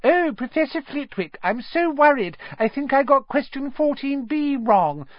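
a boy mocking a classmate in a falsetto; mock high voice. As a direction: mimic a fretting swot to tease her. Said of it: "He put on a high voice".

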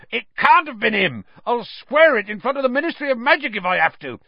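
high, loud, agitated protest; fast, heated outburst in someone's defence; ranting Hagrid. A narrator's reading. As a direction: rant on without letting anyone interrupt. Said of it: "Hagrid went ranting on".

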